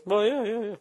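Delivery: high, loud whiny mock answer. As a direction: play the clueless sound guy in a high squeal. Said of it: "It's like".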